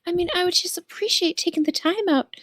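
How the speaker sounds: sweet character voice. Acted. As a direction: play it sweet and earnest.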